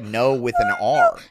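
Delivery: loud Australian "no" with an R; demonstrating an Australian accent. Shown as a demonstration. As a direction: stretch "no" into an Aussie "naur". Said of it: "They say, like".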